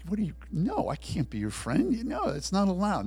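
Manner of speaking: high, stammered protest; flustered refusal. Acted. Jailer turns down the prisoner's friendship, flustered. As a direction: refuse in a jumpy, rising voice.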